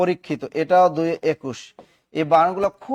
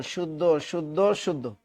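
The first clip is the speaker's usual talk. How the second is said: fading repetition; 6 dB quieter than usual. Real, not acted. Repeating correct, fading out.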